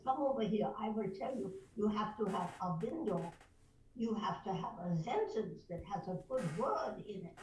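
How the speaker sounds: put-on instructing coach in a German accent; firm German-accented teacher. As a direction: lay down the rule in broadcast German English. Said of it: "and I could do German".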